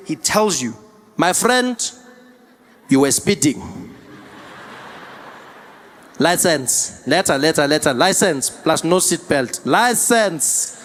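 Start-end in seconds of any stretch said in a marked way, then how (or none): 1.2-1.8 higher-pitched imitated Metro cop; South African policeman. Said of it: "the Metro Police comes there". 2.9-3.9 slow, lower Metro cop imitation; South African policeman. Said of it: "the Metro Police comes there". 6.2-10.8 imitated Metro cop making demands; South African policeman. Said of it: "the Metro Police comes there".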